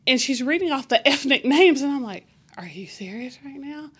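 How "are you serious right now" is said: stunned whisper; whispered disbelief. Retold or imitated. Disbelief at teammate's list.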